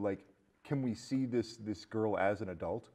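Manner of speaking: usual speaking voice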